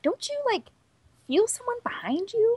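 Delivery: high, soft mock disbelief; incredulous. Viewer questioning oblivious fans, mock incredulous. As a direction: ask in a squeaky, hushed puzzlement.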